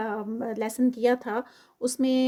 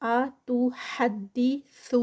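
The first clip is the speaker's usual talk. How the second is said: ordinary talk, nothing marked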